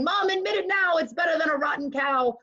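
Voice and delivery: put-on high, eager boy's shout; excited cry. A narrator's reading. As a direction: Jack calling out in triumph to his mother. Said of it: "Young Jack cried".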